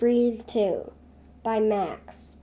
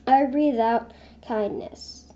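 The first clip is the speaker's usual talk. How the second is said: ordinary talk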